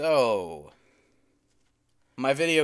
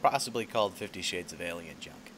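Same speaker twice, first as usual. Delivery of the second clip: nature documentary voiceover; 2 semitones lower, 4 dB quieter than usual. Acted. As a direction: narrate like a nature show host, hushed. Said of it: "a voiceover for a nature documentary".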